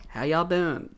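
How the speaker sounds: lightly imitated Georgia country accent, soft; a touch of Southern country twang. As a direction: mimic a mom's country drawl, quietly. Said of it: "pretty damn country accent".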